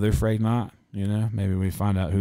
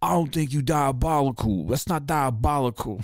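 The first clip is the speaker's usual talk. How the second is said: imitating [name]; 6 semitones higher than usual. Podcaster mocking [name]'s diabolical talk, hammed-up. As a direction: play the rapper brushing it off. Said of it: "he's like".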